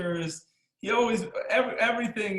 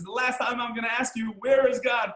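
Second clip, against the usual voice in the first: building to a higher, louder last demand; final loud demand; 6 semitones higher, 4 dB louder than usual. Retold or imitated. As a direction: ramp up to a final ultimatum from the rabbi. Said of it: "the rabbi says the last time".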